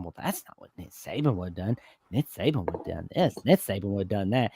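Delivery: low, fast imitation of complaining fans; grumbling fans. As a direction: mimic naysayers, quick and under the voice. Imitating grumbling fans comparing to Saban.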